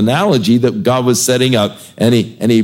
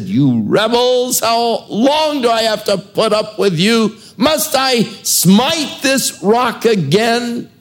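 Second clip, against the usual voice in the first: much higher, strained, slow angry rebuke; angry Moses; 11 semitones higher than usual. Acted. Moses scolding the rebellious people, high and furious. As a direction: let the fury crack out at the rebels. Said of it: "Moses was so angry with them".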